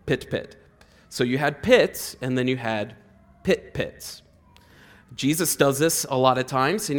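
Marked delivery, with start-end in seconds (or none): none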